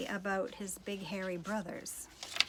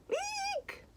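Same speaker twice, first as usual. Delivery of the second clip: very high frightened squeak; shriek. Acted. Princess shrieks at the hairy monkey, very high. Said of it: "And she says".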